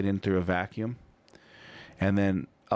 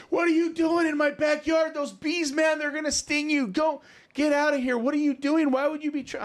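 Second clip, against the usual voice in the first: angry homeowner. Retold or imitated. Homeowner yells at the lawn guy to get out, shrill high shout. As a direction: shriek at the trespasser to leave.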